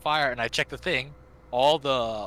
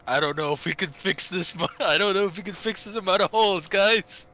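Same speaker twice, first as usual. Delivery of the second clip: mock doomed crewmate; 5 semitones higher, 4 dB louder than usual. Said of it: "Michael's just like".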